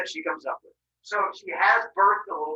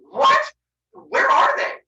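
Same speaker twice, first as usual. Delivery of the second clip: high, loud startled outburst; acted shock; 10 semitones higher, 8 dB louder, much slower than usual. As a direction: burst out in high disbelief. Zeus shocked, demands siblings' whereabouts.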